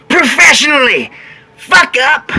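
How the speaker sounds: rally the crowd; roaring rallying speech. Acted. Hype shout.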